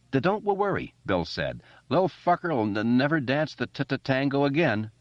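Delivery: put-on high stammering boy's voice; stuttering Bill. A narrator's reading. Bill reassuring Richie, stammering high.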